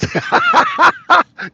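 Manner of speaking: high-pitched burst of laughter; loud laugh. Real, not acted. Host teasing Francois about deodorant, loud high laugh. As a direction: crack up loudly mid-question.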